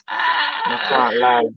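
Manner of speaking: high, slow laughing cry. Real, not acted. Joking confession, laughing loudly.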